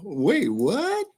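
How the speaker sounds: high, rising mock-confused "Wait, what?"; a light Nesto impression; imitating Nesto. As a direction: do Nesto's puzzled double-take. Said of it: "Sound like Nesto".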